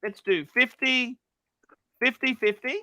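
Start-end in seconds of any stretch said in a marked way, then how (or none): none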